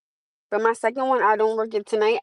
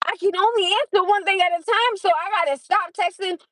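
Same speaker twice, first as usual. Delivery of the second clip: exasperated; 8 semitones higher than usual. Retold or imitated. Retold frustration at texting.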